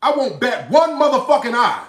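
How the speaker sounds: high, loud, indignant shouting; angry outburst. Real, not acted. Streamer rejecting a viewer's sympathy for his rival, angry shouting. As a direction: shout it back in disbelief.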